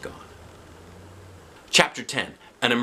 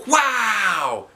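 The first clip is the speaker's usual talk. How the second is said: bright excited gasp; children amazed together; wow; 13 semitones higher, 3 dB louder than usual. Acted. The class amazed by the famous scientist. Kids react in story.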